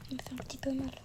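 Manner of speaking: breathy whisper. Real, not acted. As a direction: whisper it to yourself. Quiet aside after monster attack.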